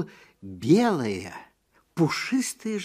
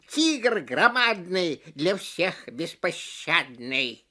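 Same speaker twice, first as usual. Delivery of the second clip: slow, taunting parrot chant; screeching parrots; slower than usual. Acted. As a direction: call it out like jeering birds. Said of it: "закричали".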